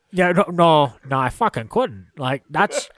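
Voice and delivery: Scottish movie-star impression; sweary Scottish star voice; Sean Connery. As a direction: slip into a Scottish actor owning up. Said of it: "Sean Connery couldn't either".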